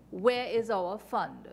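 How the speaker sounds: usual speaking voice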